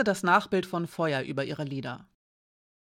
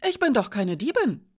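higher, louder outraged protest; indignant; 6 semitones higher, 4 dB louder than usual. Acted. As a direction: protest the accusation hotly. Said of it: "sah Janka sie empört an". (empört protestierend)

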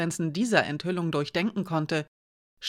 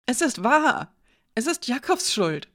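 higher, insistent man under accusation; high, urgent self-defence; agitated protest; 7 semitones higher than usual. A narrator's reading. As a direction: plead your innocence in a raised pitch. Cornered man blaming someone else.

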